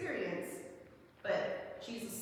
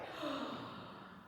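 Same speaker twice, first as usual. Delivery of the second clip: sharp gasp; 6 semitones higher, 9 dB quieter than usual. Retold or imitated. Realising the professors are a sign.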